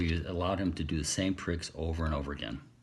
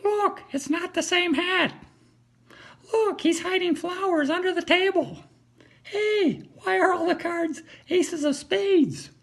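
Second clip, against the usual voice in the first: much higher, loud parrot shrieks; high, loud heckling parrot; shouting parrot; 6 dB louder than usual. Acted. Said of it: "started shouting".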